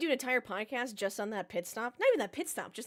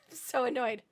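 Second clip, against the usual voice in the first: ordinary talk, nothing marked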